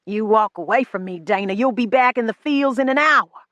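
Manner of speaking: put-on a sick young master threatening his servant; menacing threat. A narrator's reading. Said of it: "The threat".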